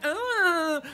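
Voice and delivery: high, airy camp "ah"; mock camp sigh. Imitating the wedding guy's camp act, a high mock sigh. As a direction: mimic the puffy camp gasp. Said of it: "being, like, all puffy and being like".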